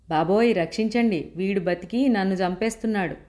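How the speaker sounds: ordinary delivery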